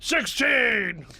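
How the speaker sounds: dumb brute voice. Acted. Dumb bravado about big brain.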